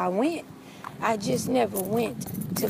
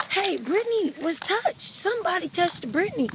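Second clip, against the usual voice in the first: high, panicky report; acted-out alarm to a camp counselor; worked up; 8 semitones higher than usual. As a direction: raise the alarm, voice pitched up. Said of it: "they trying to calm me down".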